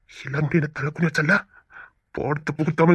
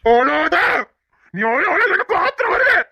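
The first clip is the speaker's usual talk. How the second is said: angry shout; 11 semitones higher, slower than usual. Acted. Husband yelling at crying wife.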